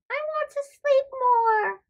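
high whiny no; sleepy girl protest. Acted. Farnaz refusing to wake up, high whiny girl voice. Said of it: "Farnaz says".

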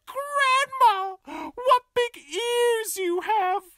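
put-on very high sweet child voice; little girl voice. Red answering at the door, high and sweet. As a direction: chirp like a small girl.

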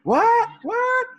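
squealed, very high and loud double what; shocked disbelief. Retold or imitated. Son just told he gets dad's car, shrieking disbelief. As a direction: shoot way up in total disbelief.

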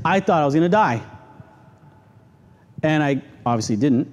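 ordinary talk, nothing marked

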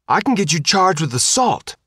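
high, sharp voice of a pushy reporter; threatening reporter. A narrator's reading. Reporter to Faith, high and threatening. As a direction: threaten him back.

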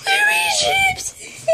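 high, slow wailing cry; wailing shout. Pretend. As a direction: wail for the chips. Character wails for chips.

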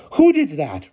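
high, loud falling demand; shrill. A narrator's reading. Headmistress repeats her demand to the girls, shrill. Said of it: "repeated Miss Scrimmage".

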